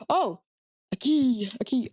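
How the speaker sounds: excited discovery, voice jumping up; surprised gasp. Real, not acted. Spotting a key in the game, gasping with surprise.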